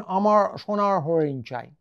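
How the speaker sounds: Bengali in a mock English accent with weakened r; mimicked English accent. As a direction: imitate an English-speaking foreigner's distorted Bengali. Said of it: "ওরা বলবেন".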